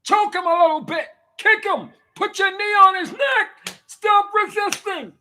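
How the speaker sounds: shouting cop. Retold or imitated. Mocking cops beating a handcuffed man.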